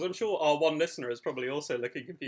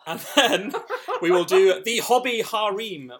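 laughing; 5 semitones higher, 10 dB louder, slower than usual. Real, not acted. Laughing announcement.

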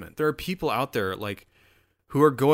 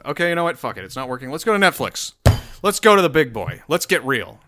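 ordinary delivery

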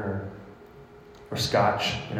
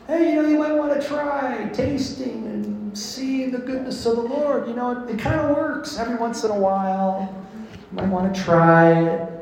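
much higher, offhand shrug of a voice, fast in the middle; mock casual suggestion; 12 semitones higher than usual. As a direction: toss it off like a lazy maybe, not a command. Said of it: "It's not a suggestion".

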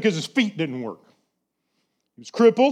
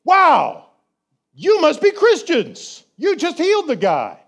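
higher, awed townsfolk voice; amazed locals; 7 semitones higher than usual. Retold or imitated. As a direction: gasp it out like a wowed villager. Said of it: "the locals don't go".

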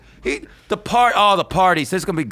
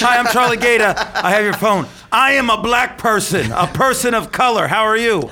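higher, louder and overly proper; a stiff, slow, formal phone greeting; mock polite caller; 6 semitones higher, 4 dB louder than usual. Charlie playing the daughter introducing herself, mock polite.

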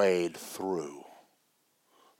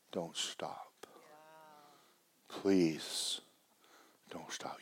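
soft unvoiced pleading; a hushed whisper begging please don't stop; 6 semitones higher, 12 dB quieter, much slower than usual. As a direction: breathe the plea barely aloud.

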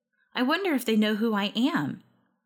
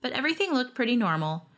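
ordinary delivery

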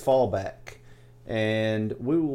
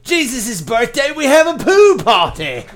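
mock Australian accent, much higher and louder; horrible Australian accent; 9 semitones higher, 9 dB louder than usual. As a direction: ham up a terrible Aussie voice. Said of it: "that was a horrible accent".

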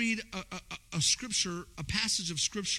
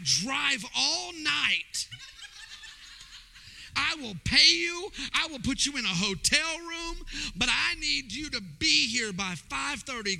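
excited urgent plea; 7 semitones higher than usual. Retold or imitated. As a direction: beg him with a laugh, pushing hard. Desperate offer to young man.

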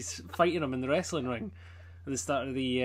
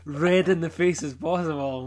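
usual speaking voice

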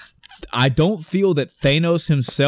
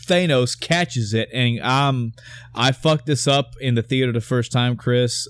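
ordinary delivery

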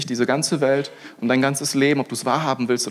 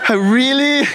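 exasperated disbelief; 10 semitones higher, 5 dB louder than usual. Retold or imitated. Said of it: "ich dachte".

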